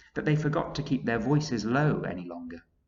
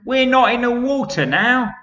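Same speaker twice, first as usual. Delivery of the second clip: scornful centipede; 12 dB louder than usual. Acted. Centipede scoffs.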